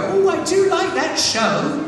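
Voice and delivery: put-on high, slow, doting little old lady voice; sweet old lady. Little old lady at a convention gushing to the actor, high and slow. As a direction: coo it like a sweet old granny. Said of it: "little old ladies come up and go".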